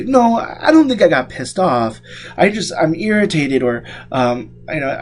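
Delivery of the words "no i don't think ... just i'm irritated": mildly correcting patient. Pretend. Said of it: "They say".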